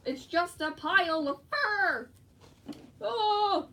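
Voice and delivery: high, loud exclamation ending in a yell; screaming. Real, not acted. Reacting to a pile of fur, loud high scream. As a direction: cry out high on a drawn-out last word. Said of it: "Like me screaming, like that".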